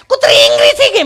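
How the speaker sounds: very high, loud shriek; mock panic. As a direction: shriek the warning in exaggerated alarm. Frightened visitor repeats the alarm.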